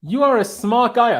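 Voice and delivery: high, loud, slow mock compliment; mock grand praise. Host hams up praise for co-host's clever theory, high and slow. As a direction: lay the praise on thick, slowly.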